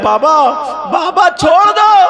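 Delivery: high, loud, slow cry; children's shouted plea. Acted. The children begging Ali to let them go, shouting. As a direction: plead desperately with father.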